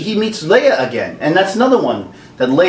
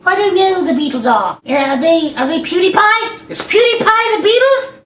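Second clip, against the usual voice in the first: much higher, mock-naive voice; clueless young viewer. Imagined viewers asking who the Beatles are, high mock-clueless voice. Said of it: "Ninety percent of my viewers will be like".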